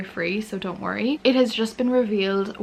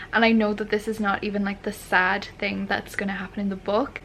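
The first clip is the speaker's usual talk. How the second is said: ordinary talk, nothing marked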